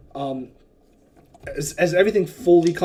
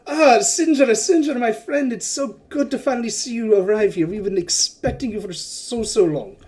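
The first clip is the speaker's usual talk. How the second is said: put-on higher, louder gushing merchant greeting an old friend; effusive merchant; 6 semitones higher, 4 dB louder than usual. Merchant Wakanga greets Sinjara, gushing higher and louder. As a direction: throw your arms open, raise the voice and gush. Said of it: "he's like".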